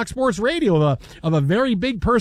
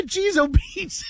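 mock Midwest exclamation; 11 semitones higher, slower than usual. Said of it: "why the".

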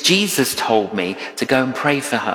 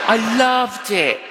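laughing exclamation; 6 semitones higher than usual. Real, not acted. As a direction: laugh it out with delight. Repeating joy about serving.

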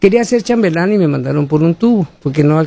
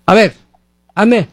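stern summons; 4 semitones higher than usual. Retold or imitated. Father ordering a breath check.

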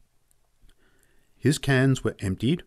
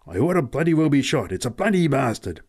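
higher, louder, agitated grumbling; agitated cursing; 5 semitones higher, 4 dB louder than usual. A narrator's reading. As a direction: curse your own bad luck emphatically. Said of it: "he kept repeating".

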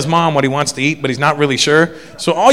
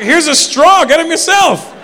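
laughing retort; 13 semitones higher, 4 dB louder, slower than usual. Retold or imitated. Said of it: "I'm like".

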